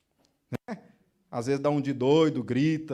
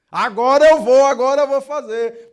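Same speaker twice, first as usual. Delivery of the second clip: impatient outburst; 7 semitones higher, 13 dB louder than usual. Acted. Impatient believer's inner voice.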